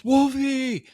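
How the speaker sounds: high, loud call across the yard; yelling the dog's name. Retold or imitated. Wife yelling for the dog at a coyote, loud call. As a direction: call the dog sharply in alarm. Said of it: "She yells".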